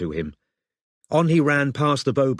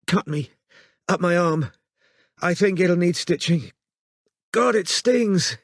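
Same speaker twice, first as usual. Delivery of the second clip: pained woman; 7 semitones higher than usual. Acted. Robin describes wound.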